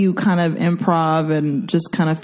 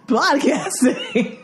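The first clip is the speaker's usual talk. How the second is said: laughing; 9 semitones higher, much slower than usual. Retold or imitated. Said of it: "What's that?".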